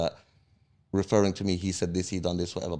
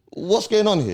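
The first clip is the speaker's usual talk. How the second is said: high, slow laughing "What's going on here?"; incredulous laugh, pitched way up; laughing disbelief; 12 semitones higher, 4 dB louder than usual. Real, not acted. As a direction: laugh it out, stunned at the nerve. Mocking disbelief at the gang.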